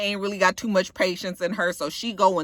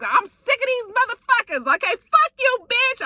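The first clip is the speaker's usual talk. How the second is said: high-pitched mock cursing out; hammed-up fury in a high voice; mimicked angry outburst; 12 semitones higher than usual. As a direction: imitate someone lashing out while held back, voice way up. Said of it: "we lost that accent".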